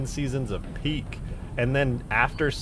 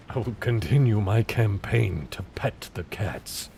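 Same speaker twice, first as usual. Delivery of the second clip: slow, loud mock proclamation; mock-solemn declaration; 7 dB louder than usual. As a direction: declare it grandly, drawn out.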